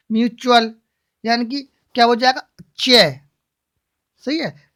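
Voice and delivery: ordinary talk, nothing marked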